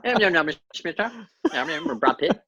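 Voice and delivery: put-on mumbled Irish Traveller pikey accent, Snatch style; a Snatch-style pikey accent, mumbled and quick; Brad Pitt pikey accent. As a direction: slur it like the pikey boxer from Snatch. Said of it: "talk exactly like Brad Pitt in Snatch".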